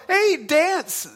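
high, loud playful order to a wolf; mock command. Pastor mocking commanding a wolf to dance, loud and playful.

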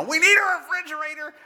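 high, pushed, exasperated; fed up and pleading; frustrated plea. Retold or imitated. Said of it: "And I'm frustrated".

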